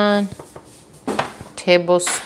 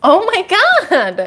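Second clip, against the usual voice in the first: alarmed; 7 semitones higher, 3 dB louder, much slower than usual. Real, not acted. Partner just fell.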